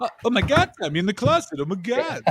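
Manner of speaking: Tom Cruise impression.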